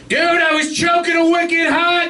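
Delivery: put-on high, slow Boston accent yelling; Boston Strangler impression. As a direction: shout it like a Boston thug, drawn out. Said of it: "This is my impression of the Boston Strangler".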